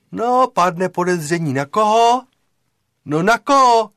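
loud, high, pressing question; insistent prompting. Acted. Plotter quizzing his friend on the suspect, insistent. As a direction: push the friend for the obvious answer.

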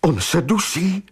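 high-pitched alarmed question; desperate cry. A narrator's reading. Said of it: "vykřikla zoufale Maggie". (zoufalý výkřik vyšším hlasem)

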